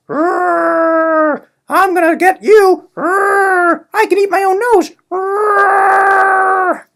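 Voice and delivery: growling hammed-up rage; mock anger. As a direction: snarl like a cartoon angry man. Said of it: "moves on to anger".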